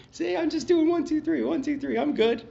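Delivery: high sing-song mock counting, pleased with himself; smug self-mockery. Mocking himself for just saying step three, sing-song.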